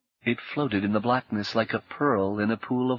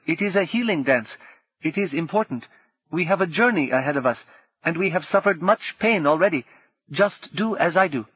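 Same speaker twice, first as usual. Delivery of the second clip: a hint of a higher, earnest old guide; lightly voiced Habu; 7 semitones higher than usual. A narrator's reading. Habu explaining the moon ring to Rini, lightly voiced earnest elder. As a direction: tell it like a gentle elder explaining spirits.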